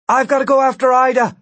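fast, high, loud call. A narrator's reading. Gwen answers Martin from overhead, calling down.

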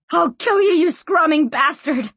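high, loud raging curse; screaming threat. A narrator's reading. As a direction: hurl it in a furious scream. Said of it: "screamed Sanchia".

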